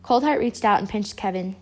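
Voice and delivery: ordinary delivery, nothing marked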